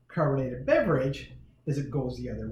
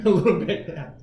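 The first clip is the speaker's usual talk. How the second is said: laughing; 3 semitones higher, 5 dB louder than usual. Real, not acted. Laughing echo of kid's answer.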